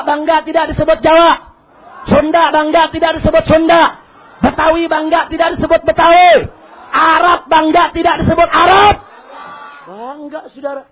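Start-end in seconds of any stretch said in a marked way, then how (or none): 0.0-8.9 loud, building roll-call questions; rallying shout. Real, not acted. Preacher asks each ethnic group if proud, rallying shout.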